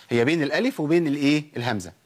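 ordinary delivery

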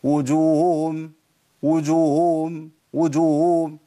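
وجوههم said as wujum, both ه lost; demo of the error. Shown as a demonstration. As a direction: show the wrong, swallowed version of وجوههم. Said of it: "فين الهائين؟". (وجوههم بدون الهاءين)

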